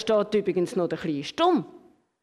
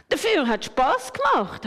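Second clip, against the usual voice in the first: higher, firm objection; protesting; 6 semitones higher than usual. A narrator's reading. The pen contradicts the others, higher and firm. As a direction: push back, a bit higher. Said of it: "hat der Kuli widersprochen".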